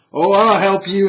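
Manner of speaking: loud, high, theatrical shout; emphatic reply. Acted. Wizard answering the wounded knight, loud theatrical cry. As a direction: call it out big and high.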